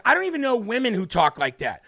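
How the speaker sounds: ordinary talk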